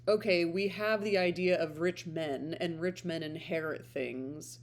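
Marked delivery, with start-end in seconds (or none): none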